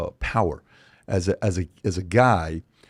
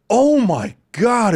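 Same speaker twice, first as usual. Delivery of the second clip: excited gasp; 11 semitones higher, 7 dB louder, much slower than usual. Retold or imitated. Kids see Iron Man on call.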